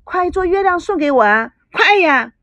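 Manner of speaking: clamor for it as if hopping up and down; excited clamoring. A narrator's reading. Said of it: "又叫又跳地说". (又叫又跳地催促)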